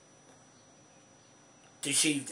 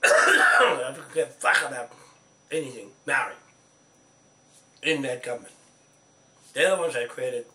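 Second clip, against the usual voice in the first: furious shout; 10 dB louder than usual. Real, not acted. Cursing the government, shouting in fury. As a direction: hammer each word out in rage.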